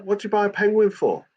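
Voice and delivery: a hint of a baffled dad; imitating his confused dad. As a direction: ask it like a bewildered old father. Son retells dad's confused questions.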